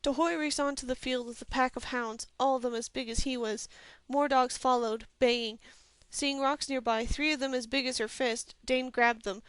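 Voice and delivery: usual speaking voice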